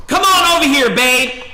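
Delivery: loud, high mocking call; mock wife yelling. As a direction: call him over like a bossy wife. Wife yelling at man in mall.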